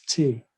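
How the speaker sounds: "T" said as the German letter, teh; German letter name "T", tay without a glide. Shown as a demonstration. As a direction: say "T" with an ay-like German vowel. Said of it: "in German".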